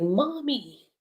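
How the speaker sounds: put-on high-pitched child calling for mom; little girl calling. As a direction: imitate a little girl's high call. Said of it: "you see a little girl running over".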